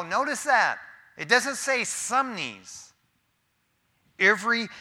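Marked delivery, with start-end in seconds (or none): 1.2-2.9 some knees drawn out slowly; stressing the contrast. Acted. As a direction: lean on "some" with a slow, pointed drawl.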